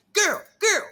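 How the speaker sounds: put-on high, loud call of girl girl; imitating Bunny calling out as she chases; calling after someone. Bunny running after her on the dance floor, high calling voice.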